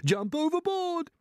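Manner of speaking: high, stretched-out panicked wail. A narrator's reading. Old grasshopper urges everyone off the peach, wailing in alarm. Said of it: "wailed the old green grasshopper".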